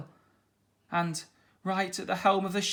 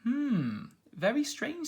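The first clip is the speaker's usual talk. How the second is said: put-on curious boy voice; 9 semitones higher, slower than usual. A narrator's reading. Flynn ponders.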